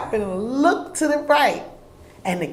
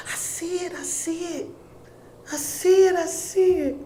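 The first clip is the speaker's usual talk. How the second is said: higher, breathless disbelief at the sign; awestruck; 9 semitones higher, 5 dB quieter than usual. Retold or imitated. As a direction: gasp it out as if your knees give way, pitched up. Knees buckling, seeing the sign.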